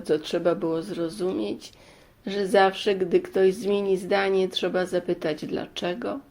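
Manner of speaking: usual speaking voice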